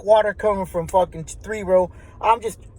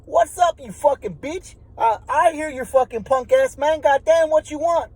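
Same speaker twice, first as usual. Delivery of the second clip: yelling back; 8 semitones higher than usual. Retold or imitated. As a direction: shout it back up to him, fed up. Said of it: "So I tell him".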